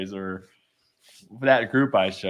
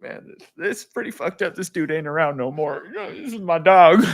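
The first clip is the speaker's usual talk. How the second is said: lightly voiced upset kid, pitched up; grieving young son; 7 semitones higher, 4 dB louder than usual. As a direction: imitate a boy lamenting his lost hero. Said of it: "even a six-year-old".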